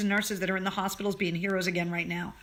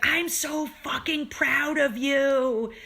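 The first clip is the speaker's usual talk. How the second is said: imitating Rob; 7 semitones higher, slower than usual. Friend's words during hug.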